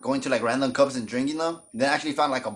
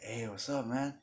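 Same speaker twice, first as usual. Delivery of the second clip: low, soft, playing it cool; 2 semitones lower, 13 dB quieter than usual. Cousin greeting him while with a girl, hushed and cool. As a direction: keep it hushed and casual, like it's nothing. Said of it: "he had, like, that voice".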